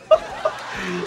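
real laugh. Real, not acted. Fan cracking up over the dentist scene, real laughter. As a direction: burst of amused laughter.

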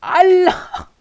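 loud, high cry of shock; astonished shout. Real, not acted. Streamer stunned by the crafting news, shouting in shock. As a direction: burst out in real amazement. Startled by message about crafting time.

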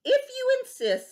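very high, loud acceptance; high mantis voice; praying mantis. Acted. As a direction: accept with relish. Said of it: "said the praying mantis".

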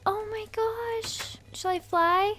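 high, slow, amazed; drawn out in wonder; awed excitement. Real, not acted. Player delighted to be wearing fairy wings, awed.

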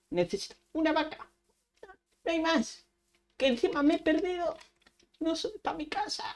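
high-pitched playful whine; high, helpless little complaint; mock desperation. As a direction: whine plaintively as if lost. Lost, cannot find a cow.

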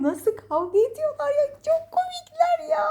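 amused squeal. Real, not acted. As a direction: voice climbing very high, then drawn out. Amused by the pets fighting. (gülmekten incelen, tiz sesle)

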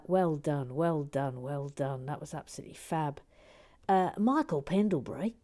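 ordinary talk

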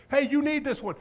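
high, loud eager call. Retold or imitated. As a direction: call it out across the bars. Calling out to a prisoner.

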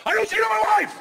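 high, loud yell; shouted declaration. Pretend. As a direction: shout it in her face for everyone to hear. Imagined yell in her face.

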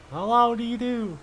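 playful high hello; high-pitched greeting. Pretend. Gamer playing with a greeting, high-pitched.

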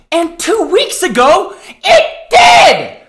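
furious punchline; angry shout. Real, not acted. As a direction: yell it out in outrage. Angry climax of rant.

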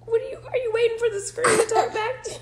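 laughing. Real, not acted. Parent laughing at child.

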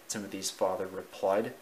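ordinary delivery, nothing marked

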